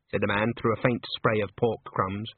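ordinary delivery

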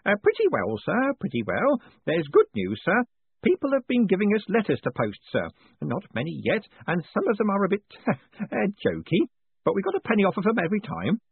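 put-on high, fussy old postman, proud of his takings; an eager old clerk showing off coins; old man proudly reporting to his boss; proud old Grote. A narrator's reading. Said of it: "he added proudly".

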